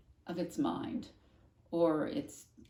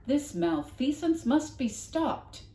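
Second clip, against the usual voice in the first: slow, grand superhero declaration; superheroic voice; 9 semitones higher, 7 dB louder, slower than usual. A narrator's reading. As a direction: proclaim like a comic-book hero. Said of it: "in a deep and superheroic voice".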